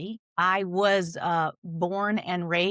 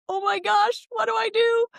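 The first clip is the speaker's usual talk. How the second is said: shrill, much higher, louder mock panic; panicked self-quote; 6 dB louder than usual. Guest recalling her book panic, hammed-up high mock panic. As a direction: play your past self flailing for help. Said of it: "when I have been like".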